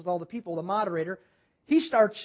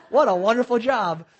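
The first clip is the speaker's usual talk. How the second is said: louder, laughing mock praise; sarcastic enthusiasm; 2 semitones higher, 7 dB louder than usual. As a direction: play up the false delight after a chuckle. Mock praise for unwanted job.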